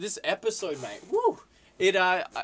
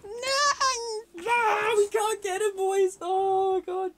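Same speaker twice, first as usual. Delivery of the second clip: high, loud cry of dismay; anguished cry; 4 dB louder, slower than usual. Real, not acted. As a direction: wail at the lost signing. Despair over losing the transfer.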